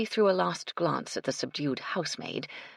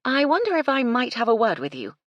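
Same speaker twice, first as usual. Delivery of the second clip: higher, louder polite request; raised voice, courteous; 8 semitones higher, 7 dB louder than usual. A narrator's reading. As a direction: project it to someone across a room. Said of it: "she said loudly".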